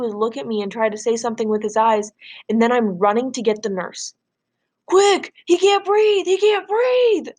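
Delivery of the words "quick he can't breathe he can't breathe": high, loud frantic shout; panicked call for help. Acted. Boy yelling to nurse that his friend is choking, panicked.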